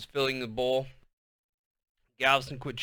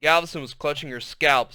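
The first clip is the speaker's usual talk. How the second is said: usual speaking voice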